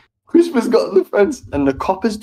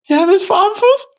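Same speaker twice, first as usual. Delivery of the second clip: panicked; 13 semitones higher than usual. Retold or imitated. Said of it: "I'm just panicking".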